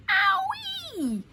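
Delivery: high, loud comic yelp; pained yelp. A narrator's reading. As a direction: squeal as if knocked flying. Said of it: "cried the crocodile".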